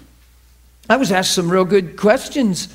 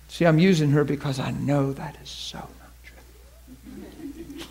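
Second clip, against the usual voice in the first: slow whisper aside; 4 semitones lower, 7 dB quieter than usual. Real, not acted. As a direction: lean in and whisper it.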